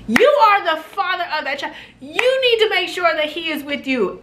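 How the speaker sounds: exasperated scolding. Real, not acted. Flight attendant telling off a careless father, high indignant scolding. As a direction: hit him with sheer disbelief.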